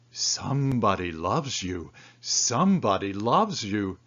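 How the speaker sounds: ordinary delivery, nothing marked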